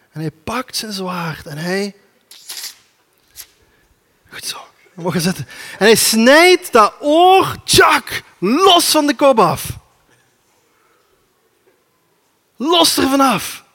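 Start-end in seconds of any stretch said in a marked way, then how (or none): 5.8-13.7 loud, slow, high narration of the sword blow; dramatic shout. A narrator's reading. Preacher to congregation, showing Peter cutting off the ear, shouting slowly. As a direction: belt out the strike like a storyteller.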